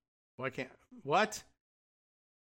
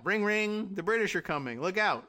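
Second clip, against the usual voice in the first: higher, hammed-up bell ringer; mock Paul Revere cry; 6 semitones higher than usual. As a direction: play the silly bell warning. Mocking Paul Revere bell version.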